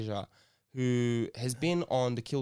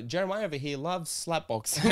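normal talking voice

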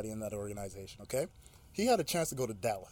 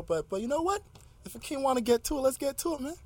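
mock hype; 11 semitones higher than usual. Host hyped for beef.

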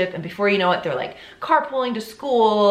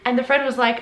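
ordinary delivery

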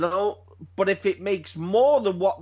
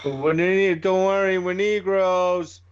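quoting movie scene; 4 dB louder, slower than usual. Retold or imitated. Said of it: "the old Negroes scene".

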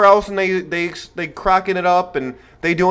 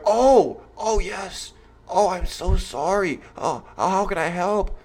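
mock sincere; 5 dB quieter, slower than usual. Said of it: "You sound really sincere".

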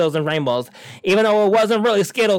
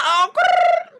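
high squealing mock growl; playful growl. Real, not acted. Teasing podcast listeners with a silly high growl.

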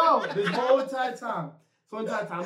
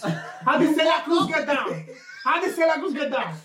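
mock outrage; 7 semitones higher than usual. Real, not acted. Incredulous rhetorical question.

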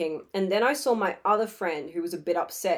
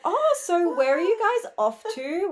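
high, lightly mocked small talk; put-on, higher-pitched friendly question; imitating chatty intruder; 10 semitones higher than usual. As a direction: pipe up as the nosy hanger-on. Acts out Max's nosy questions.

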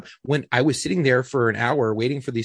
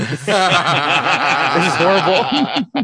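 drawn-out, higher words through laughter; laughing dismay; 5 semitones higher, 6 dB louder, much slower than usual. Real, not acted. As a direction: crack up while declaring it awful. Joking about his soreness, laughing.

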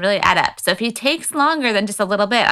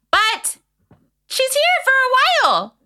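very high-pitched, gleeful announcement; excited. Retold or imitated. Host on her friend's long visit, high excited delivery. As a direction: squeal the good news in a raised pitch.

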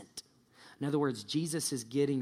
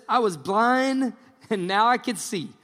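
loud, high, triumphant testimony; exultant; 7 semitones higher, 8 dB louder than usual. Acted. Healed man answers his questioners, exultant. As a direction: proclaim it with raised, joyful conviction.

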